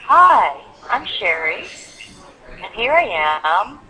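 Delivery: loud Hi, then and here I am drawn out slowly; content words slowed. Shown as a demonstration. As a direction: give the key words time, pausing between them. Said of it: "Slow down on those content words".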